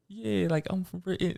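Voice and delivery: retold fake British voice; British accent. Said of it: "talking like I was from Britain".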